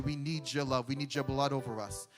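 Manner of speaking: ordinary talk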